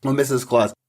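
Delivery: ordinary talk, nothing marked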